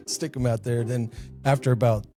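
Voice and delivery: usual speaking voice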